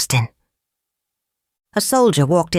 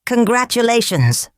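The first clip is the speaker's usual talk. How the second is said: put-on lightly French-accented, higher-pitched congratulations; 7 semitones higher than usual. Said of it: "replied the Earl with a strong French accent".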